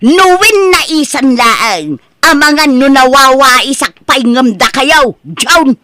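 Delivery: loud, high, aggrieved outburst; upset ranting. Acted. Mother rants at her son that nobody helps her, loud and aggrieved. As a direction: let the hurt pour out as anger.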